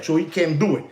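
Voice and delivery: usual speaking voice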